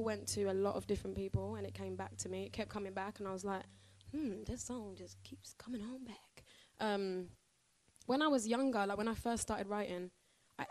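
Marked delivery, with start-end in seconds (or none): 4.1-6.4 soft, slow, half-whispered wondering; musing to herself. Retold or imitated. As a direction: think aloud under your breath, drawn out. Acts out own musing thought.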